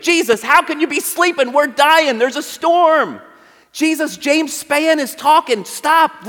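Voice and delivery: high, urgent pleading, slow on the storm; frantic disciple. Retold or imitated. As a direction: shake Jesus awake in panic. Preacher voices panicked disciples in storm.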